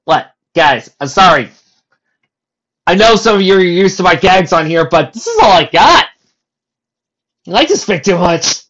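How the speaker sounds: loud, high comic apology; mock defensive. Storyteller apologising for his gags, loud mock-defensive outburst. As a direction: protest loudly to the audience.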